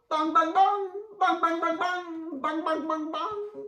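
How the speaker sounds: high loud ringing bell bongs; sung bell bongs. A narrator's reading. Narrator ringing the church bell for children, loud and high.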